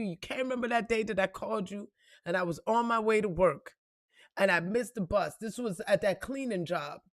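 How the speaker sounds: ordinary talk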